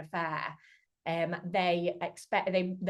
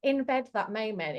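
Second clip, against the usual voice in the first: usual speaking voice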